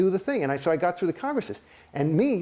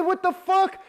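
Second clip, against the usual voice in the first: outraged cry; 12 semitones higher, slower than usual. Retold or imitated. Realizing the church does the same to him, high outraged cry. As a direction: blurt it out, stunned and furious.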